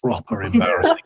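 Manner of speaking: Proper embarrassing with a high rising sneer; mock scorn.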